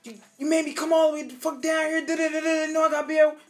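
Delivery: high, fast, angry parent rant. Retold or imitated. Parent called to school, ranting at the kid angrily. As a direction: scold the kid, fuming you got called in. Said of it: "Your parent coming to school".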